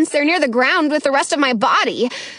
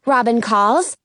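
ordinary delivery, nothing marked

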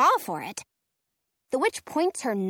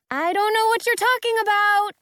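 calling out; 11 semitones higher, 6 dB louder than usual. A narrator's reading. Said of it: "Orly calls out".

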